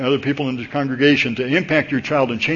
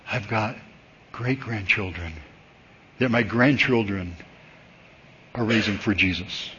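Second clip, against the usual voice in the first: moved testimony; 4 semitones lower, 6 dB quieter than usual. Retold or imitated. Preacher quoting a tearful great-grandfather after service, quietly moved. Retelling man moved to tears.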